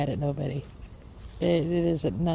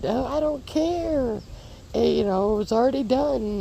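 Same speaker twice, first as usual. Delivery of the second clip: dismissive husband; 7 semitones higher than usual. Retold or imitated. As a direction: brush her off like it's no big deal. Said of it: "And he said".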